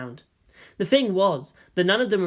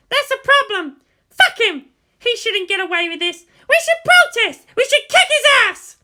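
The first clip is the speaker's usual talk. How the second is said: loud, much higher furious outburst; angry rant; 10 dB louder than usual. Acted. An outraged friend ranting about Shinji's father, loud and furious. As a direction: rage and demand action.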